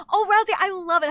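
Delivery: ordinary delivery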